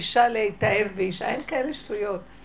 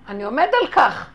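firm, much louder retort; acted loud insistence; 9 dB louder than usual. Finder refusing the crowd's protest, loud insistence. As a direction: put your foot down loudly, as in a retold quarrel.

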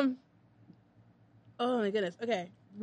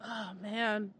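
ordinary delivery

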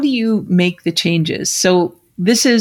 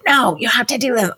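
strict parent; 7 semitones higher than usual. Retold or imitated.

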